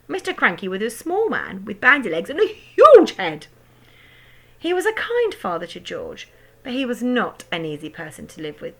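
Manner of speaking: ordinary delivery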